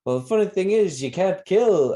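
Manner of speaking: imitating Ned. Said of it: "Ned's like".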